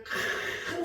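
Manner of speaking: 큰 with a long, rasping breathy onset; drawn-out emphasis. Shown as a demonstration. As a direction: stretch the opening k of 큰 hugely. Illustrates the martyrs' great voice.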